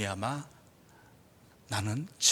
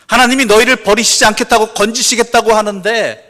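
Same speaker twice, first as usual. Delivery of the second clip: loud, high scripture declaration; full-force preaching; 8 semitones higher, 16 dB louder than usual. Real, not acted. Preacher reciting the barren fig tree verse, full-force preaching. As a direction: proclaim the verse across the hall.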